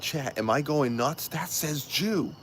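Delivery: high-pitched, disbelieving appeal to chat; incredulous. Retold or imitated. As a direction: re-enact stunned disbelief, pitch climbing. Retells asking chat in disbelief.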